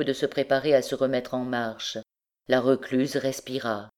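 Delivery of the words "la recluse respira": plain reading voice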